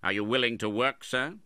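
stern, frowning. A narrator's reading. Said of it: "frowning on his nephew".

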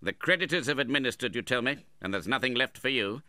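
loud, high, pressing question; impatient. A narrator's reading. Uncle quizzes widow on her money, impatient. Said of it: "said Ralph impatiently".

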